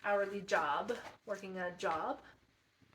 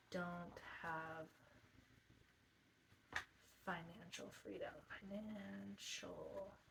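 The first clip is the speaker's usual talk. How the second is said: usual speaking voice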